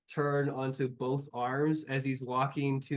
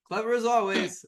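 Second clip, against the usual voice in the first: sarcastic; 8 semitones higher, 6 dB louder than usual. Acted. Sarcastic NPC remark.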